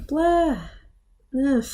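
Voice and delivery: ordinary talk, nothing marked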